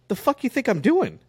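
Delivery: high, mock-exasperated snap after a sigh; sigh, then fire it back in disbelief; exasperated retort. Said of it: "I'm like".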